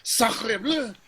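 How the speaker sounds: put-on high, loud French-accented yelling; French outburst. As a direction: yell it like an angry Frenchwoman. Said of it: "So she's like".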